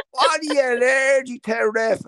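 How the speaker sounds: high, jeering heckler question; mock heckler. Sideline heckler taunts the referee, high and jeering. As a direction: needle the ref from the sideline. Heckler voiced again, slow.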